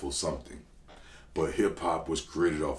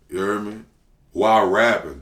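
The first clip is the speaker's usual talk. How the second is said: ordinary talk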